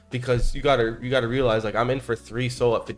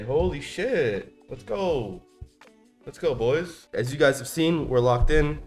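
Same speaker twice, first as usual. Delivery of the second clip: frat bro; 5 semitones higher, 3 dB louder, slower than usual. Real, not acted. Celebrating gains.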